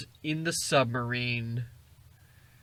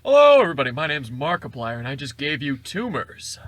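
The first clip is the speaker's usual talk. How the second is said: youtuber intro parody; 7 dB louder than usual. Retold or imitated. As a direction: belt it like a YouTuber greeting his fans. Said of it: "loud youtuber intro parody".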